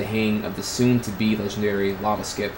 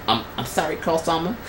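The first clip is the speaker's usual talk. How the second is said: meek apology; 7 semitones higher, 3 dB quieter than usual. A narrator's reading. Meek apology in the acted dialogue.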